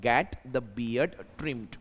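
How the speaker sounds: "get the beard trimmed" stretched out word by word, very slowly; very slow dictation. Shown as a demonstration. Teacher repeats the example very slowly for students. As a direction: say it slowly so every word lands. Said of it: "तो ये बनेगा".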